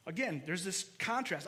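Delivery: ordinary talk, nothing marked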